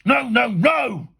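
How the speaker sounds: slow, loud, high roar; furious drawn-out refusal; thundering. A narrator's reading. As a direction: thunder each word out long. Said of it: "he thundered".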